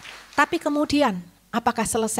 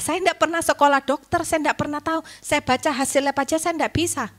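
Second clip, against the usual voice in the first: emphatic preaching; 7 semitones higher, 6 dB louder than usual. Real, not acted. Preacher stresses her helplessness.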